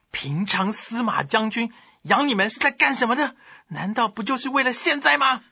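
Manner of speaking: high-pitched, scolding yell; commander's yell. A narrator's reading. 贾充 to his subordinate 陈纪, high yell. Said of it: "大喊一声说".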